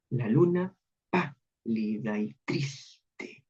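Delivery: "La luna pálida y triste" very slow, each first syllable leaned on; slow expressive recitation. Shown as a demonstration. Teacher reciting the verse to students, very slow. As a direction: let the words sink, very slowly. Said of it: "Y estas se deben sentir".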